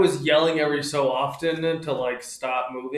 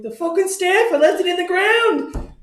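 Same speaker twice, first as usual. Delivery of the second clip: high, loud panicked yell; panicked shout; 6 dB louder than usual. Retold or imitated. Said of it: "And I'm like".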